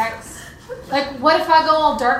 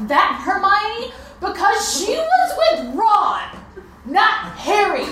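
higher, slow and emphatic indignation; indignant outburst; 6 semitones higher, slower than usual. Acted. Partner defends Hermione from role-play, indignant and emphatic. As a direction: set them straight, drawing out each word, scandalized.

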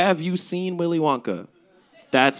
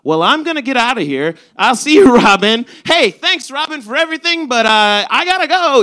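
chirpy goodbye; 8 semitones higher, 6 dB louder than usual. Retold or imitated. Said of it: "and I'm like".

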